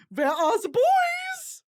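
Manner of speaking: a hammed-up German girl crying for the boys; German accent, high and tearful. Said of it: "And I'm gonna say".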